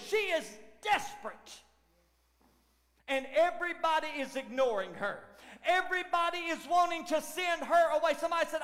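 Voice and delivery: normal talking voice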